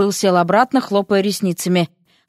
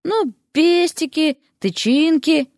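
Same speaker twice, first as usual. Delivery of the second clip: higher, slow and halting; hint delicately, stretching each word out; hesitant hinting; 7 semitones higher, slower than usual. A narrator's reading. Derek explaining with pistils and stamens, awkwardly hesitant.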